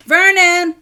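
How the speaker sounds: full angry shout; furious shout. A narrator's reading. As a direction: bellow the name, high and loud. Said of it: "then shouted".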